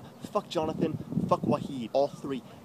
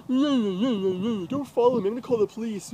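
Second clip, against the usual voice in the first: higher, whiny mock threat; mocking imitation; 5 semitones higher than usual. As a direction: mimic her complaint with scorn.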